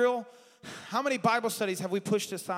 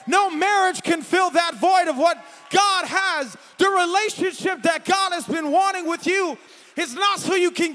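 louder, higher shouted preaching; full-force preaching; 6 semitones higher, 7 dB louder than usual. Acted. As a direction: drive it home with force.

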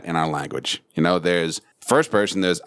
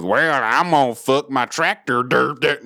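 hammed-up Southern drawl, pitched up; 5 semitones higher than usual. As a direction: imitate a tractor-driving Southern yokel. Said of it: "does she start back with".

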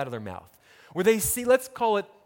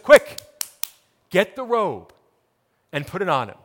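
urgent call; 7 dB louder than usual. Acted. Father urgently calling to servants.

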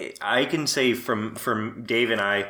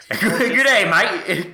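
laughing, high mock Aussie greeting; giggly put-on Aussie "Good day, mate"; mock Australian accent; 8 semitones higher, 5 dB louder, much slower than usual. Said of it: "I love fake Australian accents".

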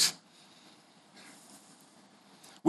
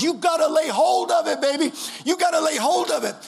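preaching at full force; 9 semitones higher than usual. Acted. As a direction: lean on hold and drag it out. Preaching refrain.

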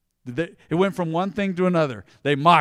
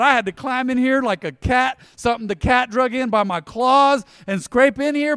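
louder, higher fired-up preaching; 6 semitones higher, 5 dB louder than usual. Acted. Believer shouting down those questioning his faith, fired-up preaching. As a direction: preach it back at the doubters.

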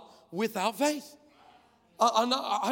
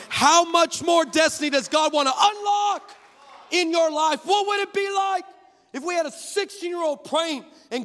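louder, rising preacher's climax; preaching at full force; 6 semitones higher, 10 dB louder than usual. Acted. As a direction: build the crowd up, shouting hope.

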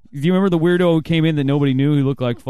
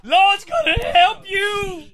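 preacher voice; much slower than usual. Retold or imitated. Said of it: "He's like".

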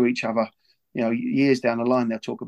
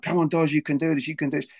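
higher, mild mock cheer from the ground; retold shouting encouragement; 5 semitones higher, faster than usual. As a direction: call up to him like the lads below, lightly. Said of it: "fifteen boys on the ground shouting out".

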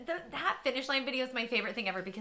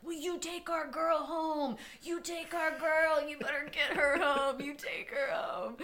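imitating coach; 6 semitones higher, 5 dB louder than usual. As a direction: hand her off with urgency. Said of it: "he's like".

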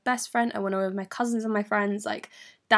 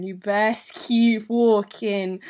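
very slow, louder imitated warning; drawn-out warning; 5 dB louder, much slower than usual. Best friend sending the boy off, drawn-out firm warning. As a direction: stretch each word, driving it home.

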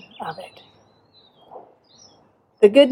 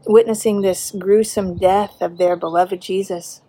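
ordinary talk, nothing marked

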